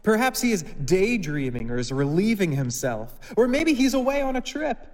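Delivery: high, loud sarcastic teasing; mock the priests with loud high sarcasm; scoffing. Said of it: "he scoffed".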